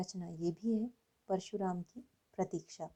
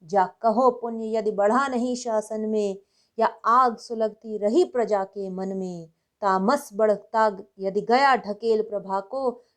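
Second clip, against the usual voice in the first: forceful, louder declamation with punched key words; impassioned poem recitation; 9 dB louder than usual. Acted. Reciting Dinkar's fiery poem to listeners, impassioned declamation. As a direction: recite it as rousing heroic Hindi verse, full of vigour.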